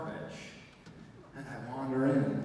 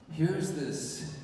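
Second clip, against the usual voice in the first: ordinary delivery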